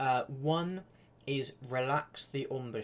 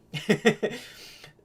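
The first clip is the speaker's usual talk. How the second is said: laugh; 8 semitones higher, 8 dB louder than usual. Real, not acted. Laugh at chat comment.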